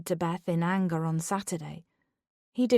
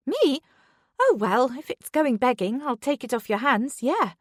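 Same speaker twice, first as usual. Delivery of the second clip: high, fake-casual coworker voice; feigned nonchalance; 5 semitones higher, 4 dB louder than usual. A narrator's reading. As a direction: act unbothered but eager. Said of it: "as if she wasn't bothered".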